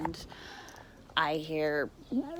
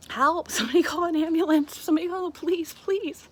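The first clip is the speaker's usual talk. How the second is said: high, loud desperate scream for help; desperate high scream calling for help; 12 semitones higher, 9 dB louder than usual. Retold or imitated. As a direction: shout it to the people watching. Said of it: "I just lost it screaming".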